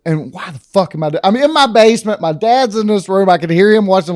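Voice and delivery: usual speaking voice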